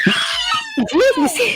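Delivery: sudden high yelp, half laughing; pained yelp. Real, not acted. Mom bitten by toddler, sharp high yelp. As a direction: yelp when the bite lands. Toddler bites and hits her.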